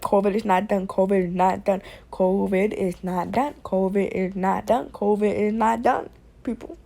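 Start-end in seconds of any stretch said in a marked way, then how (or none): none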